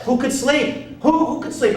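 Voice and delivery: put-on high, exasperated childhood complaint; protesting kid. Kid sent to bed during parents' party, high exasperated protest. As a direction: whine it upward in protest.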